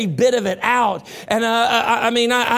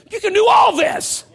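high, loud mock pep shout; mind's mock pep shout; 7 dB louder than usual. His mind urging his body on, high and loud. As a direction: cheer yourself on like a coach. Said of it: "my mind still says".

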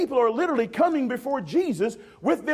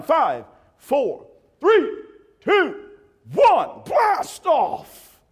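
rising, louder countdown to a shouted blast off; mock countdown; 5 semitones higher, 6 dB louder, much slower than usual. Preacher launching congregation on pretend trip: building excited countdown. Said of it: "Blast off countdown".

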